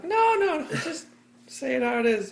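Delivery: very high, loud urging; mock urging. To people who fake being fine, high mock pleading. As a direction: squeal it high, pleading for honesty. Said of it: "I'm like".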